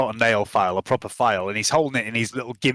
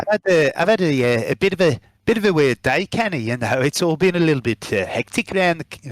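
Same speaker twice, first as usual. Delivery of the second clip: put-on Baz's accent drifting towards South African; accent slipping South African; 3 semitones higher than usual. GM as Baz, accent slipping into South African. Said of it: "it's gone South African again".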